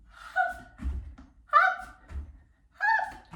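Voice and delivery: short, very high-pitched hops; bouncy hop calls. Pretend. Teacher hops along the mat with children, high bouncy calls. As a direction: pop each hop out high and separate. Calling out hops while jumping.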